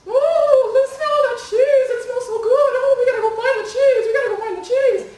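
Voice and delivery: very high, loud squeaky mouse voices; squeaky mice. Acted. Mice squeaking excitedly over the smell of cheese.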